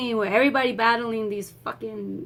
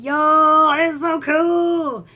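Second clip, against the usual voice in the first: loud, high, long-stretched cheer; re-enacted hype; 6 semitones higher, 8 dB louder, much slower than usual. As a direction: holler it like a thrilled fan, vowels stretched. Re-enacts thrilled reaction to graphics.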